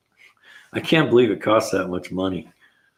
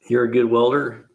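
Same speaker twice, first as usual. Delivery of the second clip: normal talking voice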